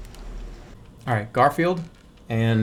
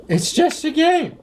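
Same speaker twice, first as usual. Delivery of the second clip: mock fish voice; 13 semitones higher than usual. Mocking the fish's voice.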